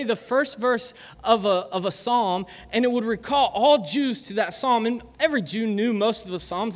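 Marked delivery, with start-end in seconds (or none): none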